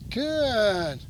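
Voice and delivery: GOOD stretched long and falling; drawn-out duration marker. Real, not acted. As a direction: hold "good" out slowly to the dog. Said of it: "Duration marker".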